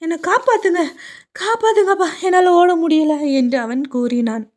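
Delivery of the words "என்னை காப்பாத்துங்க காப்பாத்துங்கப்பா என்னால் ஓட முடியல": put-on high, pleading child voice calling for help; scared cry for help. Little boy calling to his father for help, frightened. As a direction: beg to be saved, can't run any more.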